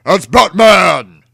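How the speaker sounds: villain crowing as Batman arrives; loud exclamation. A narrator's reading. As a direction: bellow it in glee.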